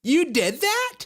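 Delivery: high-pitched, exaggerated mock amazement; mock impressed men. Men falling for her flirting, mock impressed and high. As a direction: act out gullible men gushing with rising pitch. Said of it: "men are just like".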